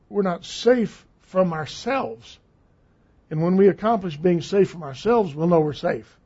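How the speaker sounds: normal talking voice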